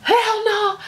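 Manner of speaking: high-pitched mock panic; freaked out. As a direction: act out a startled refusal. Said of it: "y'all know I freaked the hell out".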